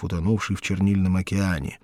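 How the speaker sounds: ordinary delivery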